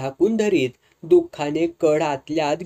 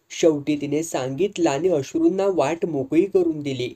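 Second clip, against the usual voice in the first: ordinary delivery, nothing marked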